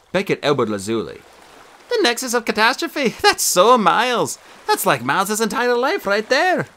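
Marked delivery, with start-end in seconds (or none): 1.9-6.7 laughing mockery. A narrator's reading. Beckett mocking brother's phrase.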